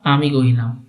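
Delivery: ordinary delivery, nothing marked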